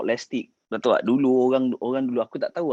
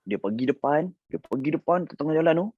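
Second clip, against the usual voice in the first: ordinary talk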